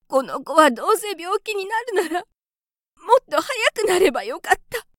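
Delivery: high, tearful little mouse mother lamenting; a small creature's high voice, half in tears; grieving mother mouse. A narrator's reading. Mother mouse mourning her sick child, high and tearful. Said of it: "泣き出してしまいました。".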